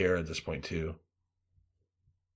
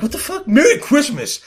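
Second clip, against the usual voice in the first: very high, loud road-rage shout; furious holiday yell; 11 dB louder than usual. Retold or imitated. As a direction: scream the greeting at the driver.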